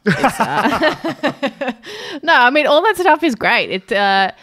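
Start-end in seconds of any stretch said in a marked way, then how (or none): 0.4-3.7 laughing it off, higher and louder; breaking into laughter after a joke. Real, not acted. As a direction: laugh through the words as you take the joke back.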